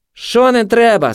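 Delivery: high, loud, drawn-out challenge; slow high-pitched shout; aggressive junkie. A narrator's reading. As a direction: throw it back at her mockingly.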